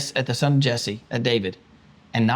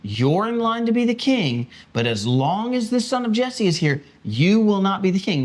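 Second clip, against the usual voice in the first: higher, insistent king's warning; Saul reasoning with son; 6 semitones higher than usual. Retold or imitated. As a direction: press the point hard and high. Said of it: "the preposition that he gives is".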